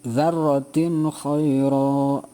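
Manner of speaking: "Dzarratin khaira" very slow, the n crisp before kh; clear izhar recitation. Shown as a demonstration. Teacher modelling the last example, slow and clear. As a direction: stretch it out with a clean n. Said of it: "Sama juga jelas".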